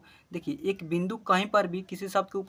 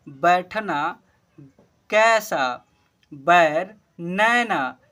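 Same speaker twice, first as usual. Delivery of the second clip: each word called out louder and clear, one by one; loud word-list reading; 6 dB louder, much slower than usual. Shown as a demonstration. Teacher calling out Hindi words to pupils, loud and clear. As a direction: read every word out loud for the class to repeat.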